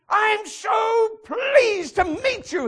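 high, loud, slow gushing cry; gushing delight. Acted. Movie man greets a stranger, slow high gushing shout. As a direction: overflow with innocent joy. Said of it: "he says".

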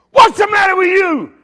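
very high, loud mock hollering; counterman's yell. Deli counterman to a confused newcomer, yelled. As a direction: holler it brusquely at the customer.

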